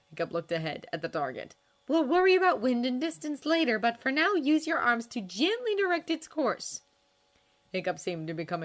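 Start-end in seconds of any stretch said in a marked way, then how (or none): 1.9-6.8 put-on a higher, louder young woman giving firm instruction; brisk trainer voice, patient but firm; Astrid coaching. A narrator's reading. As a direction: coach him like a confident warrior girl.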